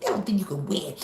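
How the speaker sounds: ordinary talk, nothing marked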